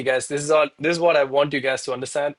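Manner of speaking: ordinary talk, nothing marked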